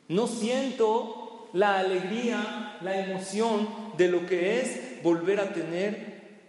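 very slow, drawn-out words; a soldier slowly owning up to what he lacks; slow heartfelt confession. Retold or imitated. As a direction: let each word weigh as you admit it. Soldier confesses missing feeling.

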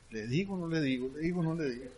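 low, soft musing to himself; hushed aside. Retold or imitated. Speaker debating whether to tell the driver, hushed aside. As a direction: weigh it up privately under the voice. Teller's inner debate before answering.

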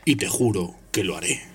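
a cold, hushed threat; Snape's voice. Acted.

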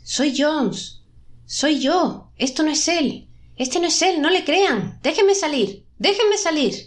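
high, urgent cries to be let out; desperate plea. A narrator's reading. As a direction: plead like someone trapped, pitch up high. Voz atrapada que suplica salir. (súplica desesperada, voz aguda y urgente)

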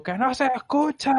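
high imitation of a friend hollering; mock yell across to the neighbours; a gritos. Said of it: "A gritos". (imitando al amigo gritando, muy agudo)

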